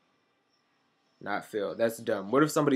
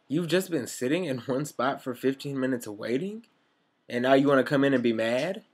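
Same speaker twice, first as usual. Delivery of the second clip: ordinary delivery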